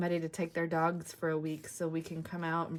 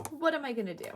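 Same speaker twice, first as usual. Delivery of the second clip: same question again pitched higher, mock-mysterious; higher, sing-song teasing repeat; higher playful repeat; 7 semitones higher, faster than usual. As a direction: lift it up playfully to tease.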